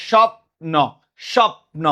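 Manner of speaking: স্বপ্ন broken as "shop... no"; pronunciation demo. Shown as a demonstration. Teacher has students pronounce it, স্বপ্ন split "shop-no", no vowel after p. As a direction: close firmly on the p of স্বপ্ন, then "no". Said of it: "তো উচ্চারণ করো তো".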